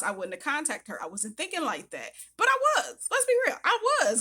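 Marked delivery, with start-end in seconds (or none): 2.4-4.2 much higher, louder confession; sudden admission. Real, not acted. Admitting to viewers she was desperate, high loud blurt. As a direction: blurt out the truth you were hiding.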